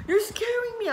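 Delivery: high, loud retold panic; tearful fright. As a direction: re-enact a frightened, tearful plea. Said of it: "I was, like, in bare tears".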